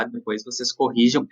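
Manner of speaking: usual speaking voice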